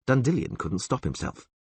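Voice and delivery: plain reading voice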